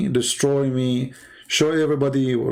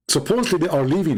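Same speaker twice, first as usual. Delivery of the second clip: ordinary talk, nothing marked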